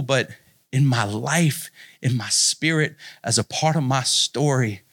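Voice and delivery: reflective preaching. Acted. Pastor applying the story to the congregation, low and earnest.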